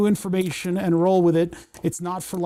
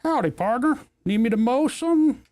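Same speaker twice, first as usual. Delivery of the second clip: cowboy voice; 7 semitones higher than usual. Pretend. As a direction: play a folksy cowboy robot. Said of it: "talks like a cowboy".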